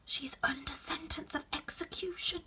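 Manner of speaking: high, soft secret whisper. Acted. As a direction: breathe it in her ear. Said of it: "and whispered".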